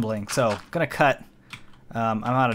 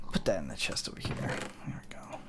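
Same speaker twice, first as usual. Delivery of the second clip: soft whispered aside; whispering; 8 semitones lower, 12 dB quieter than usual. Real, not acted. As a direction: whisper it to yourself. Whispered aside while building.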